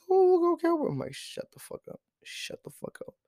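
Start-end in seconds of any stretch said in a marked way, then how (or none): 0.1-0.9 very high mock kid voice; high-pitched mimicry of cheering kids; mocking school kids. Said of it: "These kids, they're like". 1.1-3.2 hushed, voiceless telling-off; exasperated whisper; whispered retort. Retold or imitated. Retold retort to annoying kids.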